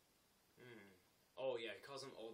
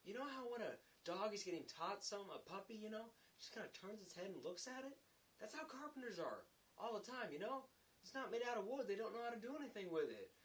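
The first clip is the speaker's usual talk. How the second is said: high-pitched, lightly acted ribbing; mocking explanation; 10 semitones higher than usual. Laborer comparing carpenters to puppies, mock explanatory. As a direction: explain it as a teasing put-down.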